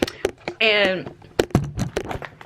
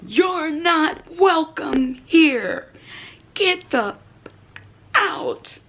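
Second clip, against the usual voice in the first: slow, higher, firm scolding; telling off roach; 6 semitones higher, much slower than usual. Pretend. Ordering the cockroach out of her home, slow and firm. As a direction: lay down the law slowly. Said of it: "telling off the roach".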